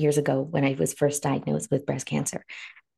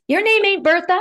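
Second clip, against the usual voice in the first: mock sassy retort; 14 semitones higher, 6 dB louder than usual.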